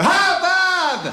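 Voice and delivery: very high, loud drawn-out exclamation; mock delighted recognition. Killer recognises Bob behind the mask, high loud surprise. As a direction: cry out the name as if finally getting the prank.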